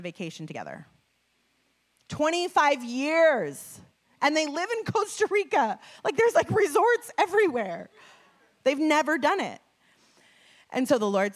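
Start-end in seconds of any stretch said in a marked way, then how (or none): 2.1-9.6 loud, high-pitched disbelief; raised voice, punching every phrase; emphatic amazement. Real, not acted. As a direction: exclaim it, utterly astonished. Amazed at couple never vacationing.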